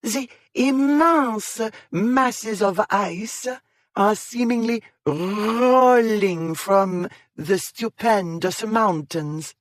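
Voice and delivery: grand, slow stage-French announcement; overdone French accent. Acted. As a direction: stretch it out like a head-cold Frenchwoman. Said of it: "pronouncing the R's as though she had a violent head cold".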